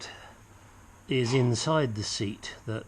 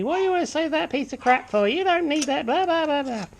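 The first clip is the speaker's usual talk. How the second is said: high-pitched, rapid mock wifely nagging; 4 dB louder than usual. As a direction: imitate a wife scolding in a fast, squeaky-high voice. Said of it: "and you say".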